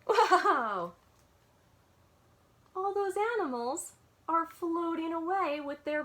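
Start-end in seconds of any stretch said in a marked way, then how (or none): 0.1-0.9 loud amazed whoa; loud delighted exclamation; surprised whoa. Real, not acted. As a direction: gasp out a big whoa at the picture. Reacting to picture.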